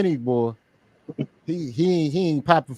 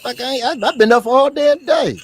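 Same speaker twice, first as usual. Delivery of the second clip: mock indignation; 9 semitones higher, 3 dB louder than usual. Mock outrage at greeting.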